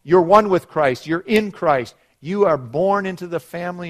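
ordinary talk, nothing marked

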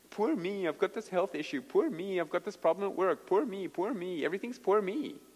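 mock self-pity. Preacher mimicking people who are no fun around, whiny self-pity. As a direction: mimic a tiresome complainer. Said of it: "Don't you love people like that?".

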